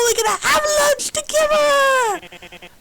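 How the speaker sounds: high, slow squealing shout of joy; a robot screaming with delight; thrilled shout. Acted. Robot shrieking joy at lunch plans, high and slow.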